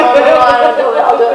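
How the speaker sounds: mimicked scramble to volunteer; retold clamour. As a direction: blurt it over everyone else. Producers reacting to her volunteering.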